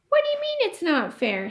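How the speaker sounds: put-on high, pushy kid voice; indignant boy. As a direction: challenge him like a cocky kid.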